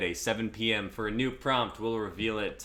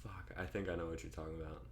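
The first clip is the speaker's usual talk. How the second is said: whisper; 11 dB quieter, faster than usual. Real, not acted. Whispered aside.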